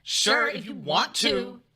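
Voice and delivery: high, slow filtered wife voice; imitating wife through filter. Husband pretending to be his wife with the filter, high drawn-out voice. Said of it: "pretend to be me now".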